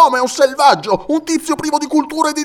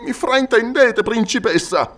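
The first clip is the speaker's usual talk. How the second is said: wounded protest, falling away at the end; deeply hurt; 10 semitones higher, 4 dB louder than usual. A narrator's reading. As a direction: plead mildly as a wounded noble. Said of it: "profondamente ferito". (tono ferito, lieve protesta)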